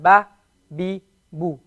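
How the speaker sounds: ba, bi, bu each clipped short; crisp single-beat ba, bi, bu; short vowels. Shown as a demonstration. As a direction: keep ba, bi and bu short, no stretch. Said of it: "We don't stretch the e sound".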